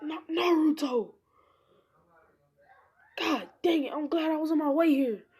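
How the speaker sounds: high-pitched, exasperated outcry; alarmed scolding. Acted. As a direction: cry out in mock alarm at a reckless pupil. Hokage scolds Naruto after the attack.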